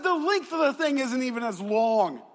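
loud, high, indignant protest; fired up. Retold or imitated. Customer arguing with burrito shop staff, fired-up shouting. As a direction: build to an exasperated shout. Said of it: "I was getting fired up".

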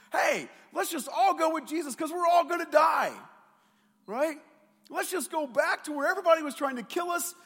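loud, high mock-doom sarcasm; a sarcastic disciple yelling that they'll all die; sarcastic Thomas. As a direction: shout it like a doomed grumbler rolling his eyes. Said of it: "sense the sarcasm in Thomas's voice".

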